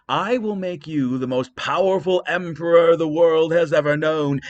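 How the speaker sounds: a queen goddess bribing with an empire; Hera tempting. Acted. Hera to Paris, a grand loud bribe. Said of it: "said Hera".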